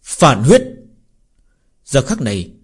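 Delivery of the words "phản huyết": loud battle cry; furious roar. Acted. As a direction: roar the technique name in rage. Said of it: "nổi giận gầm lên một tiếng". (gầm lên giận dữ)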